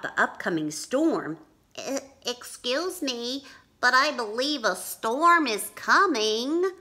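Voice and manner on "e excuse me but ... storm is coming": high, hesitant polite voice; Henry trying to warn a busy pirate; polite timid bunny. Acted. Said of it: "Henry said".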